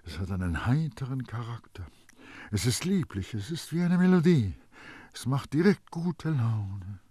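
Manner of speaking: swooning, fading to a breathy end; enraptured. A narrator's reading. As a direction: marvel to yourself at a lovely scent. Baldini murmurs on, enraptured by perfume.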